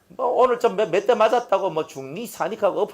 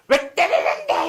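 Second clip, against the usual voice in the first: defiant back talk; 12 semitones higher, slower than usual. Retold or imitated. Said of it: "이렇게 하고 있는 놈은 더 맞아요".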